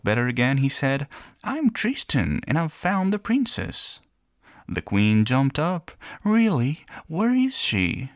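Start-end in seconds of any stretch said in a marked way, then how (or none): 1.4-4.0 put-on much higher little boy voice; high, proud tiny boy; small boy voice. Small boy introduces himself. 6.2-8.1 much higher eager question; breathless hopeful queen; excited queen. Acted.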